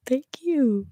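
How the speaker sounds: crying. Real, not acted. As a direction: whisper through real tears. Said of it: "I'm crying".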